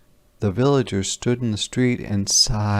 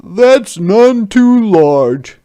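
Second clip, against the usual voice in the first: much higher and louder, grand; proclaiming soldier; 12 semitones higher, 10 dB louder, slower than usual. Acted. Soldier announcing stone soup to villagers, grand raised voice.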